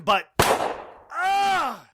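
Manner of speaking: high yell breaking out; a sudden enraged cry; sudden outburst. Acted. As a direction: let the rage burst out. Attacking, bursts into a cry.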